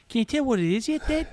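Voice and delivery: very high put-on Aussie voice; little high voice. Shown as a demonstration. Co-host copies his act in a little high voice. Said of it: "you just put this little high voice on".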